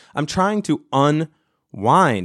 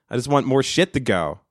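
normal talking voice

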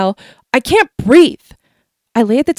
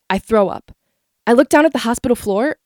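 plain reading voice

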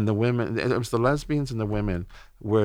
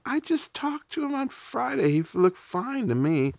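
high-pitched, disbelieving protest; stunned disbelief. Retold or imitated. Man recalling news of friend's sudden death, in high disbelief. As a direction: relive the shock, voice jumping up high.

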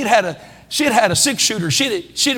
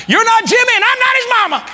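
high-pitched parental comeback; exasperated parent retort; 9 semitones higher, faster than usual. Acted. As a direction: shut down the child's argument, pitched up high. Parent retorts to a pleading child.

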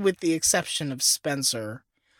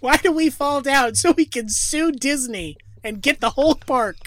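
mock grand dad; 8 semitones higher, 5 dB louder than usual. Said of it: "Batman Begins parody".

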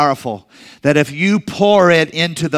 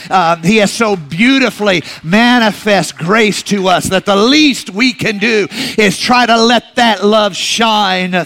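rising preaching climax; 3 semitones higher than usual. Real, not acted. Pastor lifting the congregation's praise, swelling preaching climax. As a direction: swell the praise up, then let it settle.

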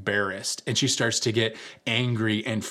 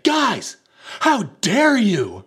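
high, loud mock outrage, drawn out; hammed-up indignant yell; fury and rage; 11 semitones higher, 7 dB louder, much slower than usual. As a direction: act out a furious friend's shriek. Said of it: "in a moment of, like, fury and rage".

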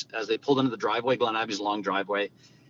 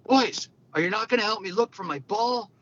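higher mock call across the course; retold yell; 10 semitones higher than usual. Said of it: "M J yells over at us".

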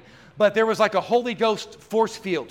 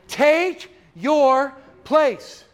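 preaching exhortation; 6 semitones higher, 4 dB louder, much slower than usual. Real, not acted.

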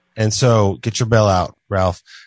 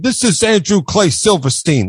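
slow, higher-pitched mock self-introduction; Andrew Dice Clay; 6 semitones higher than usual. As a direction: imitate the comic announcing himself, drawn out. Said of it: "He never said".

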